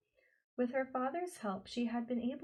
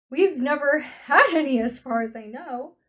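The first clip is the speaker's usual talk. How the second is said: loud, emphatic protest; exclaiming; 5 semitones higher, 16 dB louder than usual. A narrator's reading. Calvin insists to the beasts, loudly emphatic. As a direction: keep pressing the point hard. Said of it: "Calvin exclaimed".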